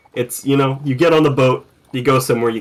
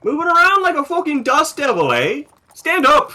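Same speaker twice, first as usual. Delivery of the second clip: much higher, scolding guard voice; bossy air marshal; 13 semitones higher than usual. Acted. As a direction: bark at the scampering lizard like a petty officer. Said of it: "two air marshals who are just like".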